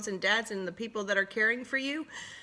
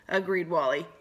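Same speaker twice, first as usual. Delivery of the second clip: ordinary delivery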